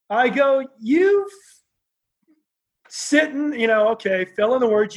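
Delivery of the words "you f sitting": high, strained start of a furious insult; angry outburst. Retold or imitated. Young wrestler starting to cuss out the promoter, angry. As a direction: spit the words out at him. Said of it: "calling him every name".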